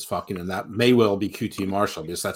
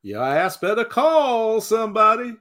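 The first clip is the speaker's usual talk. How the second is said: shouted catchphrase; 13 semitones higher, 5 dB louder than usual. Acted. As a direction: belt it out like a wrestling entrance. Co-host belts out a catchphrase.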